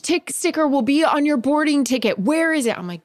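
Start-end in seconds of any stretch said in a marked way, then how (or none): 0.0-2.2 high-pitched imitated official nagging; put-on high, insistent attendant voice; impatient flight attendant. 2.2-2.8 high, insistent mimicry; put-on high demanding voice; impatient flight attendant.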